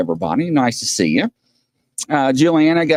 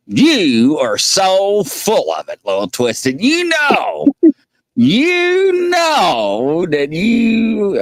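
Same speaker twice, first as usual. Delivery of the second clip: slow, stretched teasing drawl; mock scolding a friend, slowly; playful teasing; 6 semitones higher, much slower than usual. Real, not acted. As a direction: drag it out to rib a friend.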